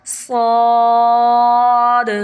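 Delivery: स्वाद held long and loud with a bouncing echo on the end; echoing qalqala. Shown as a demonstration. Teacher shows students how the voice shakes in qalqala. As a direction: stretch स्वाद, then let the final letter bounce. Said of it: "आप देखें कलकला में मेरी आवाज़".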